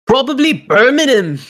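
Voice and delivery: put-on high, loud, drawn-out Birmingham accent. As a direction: imitate a thick Birmingham accent, slowly. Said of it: "What's the worst accent?".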